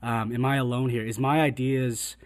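ordinary talk, nothing marked

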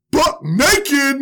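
blurted high and loud; buck naked punchline. Retold or imitated. Comic teller blurting the punchline, loud and high. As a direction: burst out with the punchline.

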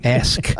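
"Ask" with a clear k at the end; correct ask. Shown as a demonstration. Host teaching producer to say ask. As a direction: finish "Ask" with a crisp k. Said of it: "Ask with a K on the end".

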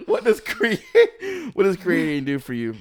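high, loud laughter breaking up the question; laughing. Real, not acted. Host cracking up while asking the guest a question, laughing. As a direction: try to ask through the giggles.

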